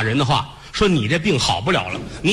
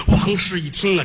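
ordinary delivery

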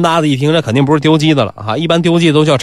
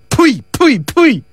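three short, high spat puhs; mock spitting; 10 semitones higher, much slower than usual. Nisi rebuffing the fawning doorman, mock spitting. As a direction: spit out each one separately.

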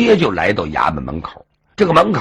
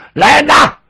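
much higher, loud summons; loud call; 7 dB louder than usual. Acted. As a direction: call the guards in with full force. Official calls the guards.

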